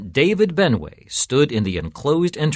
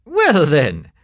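raised, louder jovial reply; a touch of laughter, pitched up; laughing priest; 7 semitones higher, 7 dB louder than usual. A narrator's reading. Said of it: "laughed Father Peterson".

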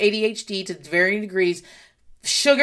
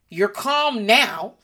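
ordinary talk

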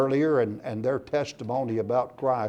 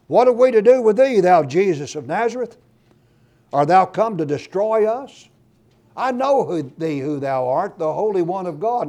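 high, loud terrified cry of a demon; 6 semitones higher, 7 dB louder than usual. Retold or imitated. Unclean spirit cries out to Jesus in the synagogue, loud high terrified cry. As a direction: shriek it out like a cornered devil. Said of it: "And he cried out".

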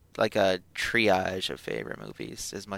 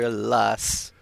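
ordinary talk

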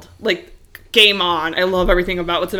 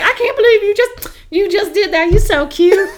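high, sweet imitation of a Southern accent; Southern lady; 11 semitones higher, 4 dB louder than usual. Georgia tourist praising the clerk, imitated Southern accent. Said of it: "little Southern lady".

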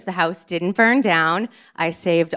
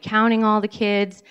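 ordinary delivery, nothing marked

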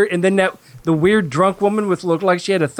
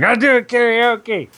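fake drunk voice; 4 semitones higher, slower than usual. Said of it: "your fake drunk voice".

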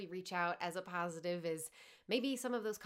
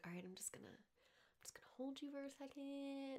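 a hint of a soft whispered husband, much softer; husband soothing; 7 semitones higher, 11 dB quieter than usual. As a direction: murmur it gently, like holding someone. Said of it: "my husband's just like".

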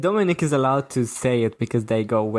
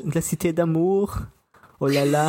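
higher hammed-up French swoon; mock French; 5 semitones higher than usual. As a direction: croon it like a cliché.

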